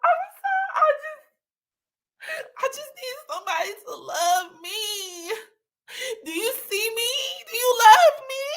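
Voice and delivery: high, sobbing plea, put on; fake crying. Demonstrating crying at a man to win, high fake sobbing. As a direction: turn on the waterworks and beg. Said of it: "I would turn on the waterworks".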